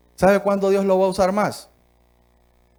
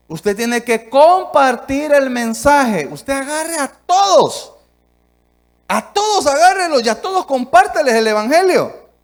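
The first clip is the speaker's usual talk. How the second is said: fiery exhortation; 7 semitones higher, 6 dB louder than usual. Acted. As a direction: urge them at the top of your voice. Preacher exhorts at full force.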